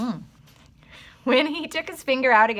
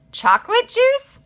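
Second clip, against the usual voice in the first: much higher, louder wondering question; delighted surprise; 13 semitones higher, 7 dB louder than usual. A narrator's reading. John realizes his juice tastes of chocolate, loud delighted wonder. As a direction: marvel at it out loud.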